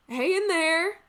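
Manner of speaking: loud, high holler; calling out. Acted. Maid hollering into the tavern from outside, loud call. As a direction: shout to people indoors.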